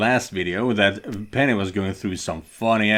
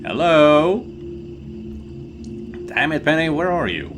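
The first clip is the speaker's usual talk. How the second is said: calling out; 7 semitones higher than usual. Acted. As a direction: call out anxiously for her. Calling for a missing friend.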